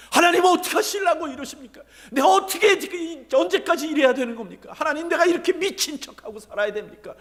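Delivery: high, loud cry of protest; shouting to God. Acted. David pours out his grievance to God in the valley, shouting. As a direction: shout it out enough to shake the valley. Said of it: "계곡이 떠나라고 소리 지르고".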